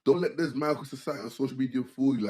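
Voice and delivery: ordinary talk, nothing marked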